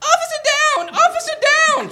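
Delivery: very high, squealing cry; squeaky cry. Acted. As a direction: squeal it out in a tiny voice. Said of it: "she cried".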